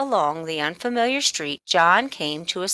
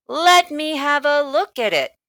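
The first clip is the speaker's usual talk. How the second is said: sly storekeeper; 7 semitones higher, 5 dB louder than usual. A narrator's reading. Storekeeper eyeing the coin in John's hand, sly and playful. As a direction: tease the boy knowingly.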